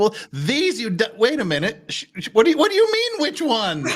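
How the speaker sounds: high, climbing mock bewilderment; imitating the detective realizing the trap; mock confusion. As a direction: play him catching on in disbelief, pitch rising. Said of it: "you can see him kinda almost process".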